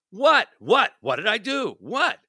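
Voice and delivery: high, rising indignant protest; defensive. Acted. Accused spouse to partner in acted quarrel, indignantly defensive. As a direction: protest innocence with mounting disbelief.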